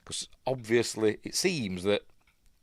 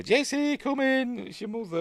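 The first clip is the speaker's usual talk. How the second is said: high calling-in voice, stretched out; a mum calling her kid in from the doorstep; mother calling child. Retold or imitated. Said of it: "he gets called in".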